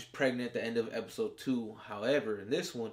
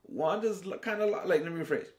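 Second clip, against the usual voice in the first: excited fan reaction; 7 semitones higher than usual. Retold or imitated. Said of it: "And I was like".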